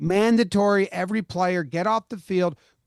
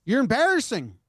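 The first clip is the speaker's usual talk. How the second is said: higher-pitched imitation of a screaming fan; mildly yelled, at no great volume; mock yell; 7 semitones higher than usual. Quoting a friend screaming at the team, mock yell. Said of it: "he's screaming this".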